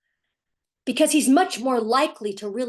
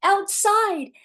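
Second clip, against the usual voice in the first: mock child answer; 11 semitones higher, 5 dB louder than usual. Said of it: "assuming he said the right answer".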